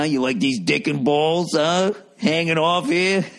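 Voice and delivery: hammed-up flaunting guy; playful sleazy taunt; mock show-off. Mocks naked guy posing.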